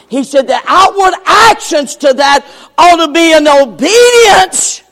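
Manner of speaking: loud, rising sermon delivery; fiery preaching. Acted. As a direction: preach it hard to the congregation. Preacher at full force.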